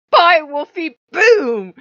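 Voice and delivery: a loud gloating farewell; gleeful shout. Retold or imitated. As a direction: yell it as you shoot the wolf. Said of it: "I was like".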